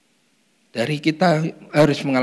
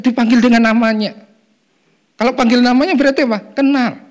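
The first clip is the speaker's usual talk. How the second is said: higher and louder, fast excited preaching; 9 semitones higher, 6 dB louder than usual. Real, not acted. Preacher excitedly asks the congregation what names mean. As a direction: drive the point home with energy. Excited climax after laugh.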